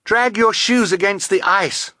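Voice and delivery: high, urgent order; urgent cry. A narrator's reading. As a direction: cry out an emergency instruction. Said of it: "Violet cried".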